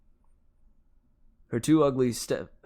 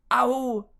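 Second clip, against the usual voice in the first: high, loud yelp; pained yell; 13 semitones higher, 6 dB louder than usual. A narrator's reading. Grover grabbing the burning whip, pained yell. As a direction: yelp as if burned. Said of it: "he yelled".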